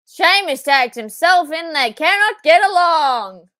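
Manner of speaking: real thrill at the tag; excited shout. Real, not acted. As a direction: yell it at the screen. Shouting at wrestling on screen.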